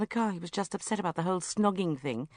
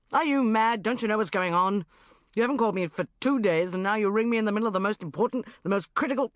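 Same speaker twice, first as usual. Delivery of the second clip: louder, fast exasperated rant; irritated scolding; 4 semitones higher, 5 dB louder, faster than usual. Acted. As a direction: rattle it off, fed up and rushed. Mark scolds her mid-match.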